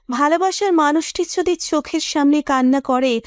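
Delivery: plain reading voice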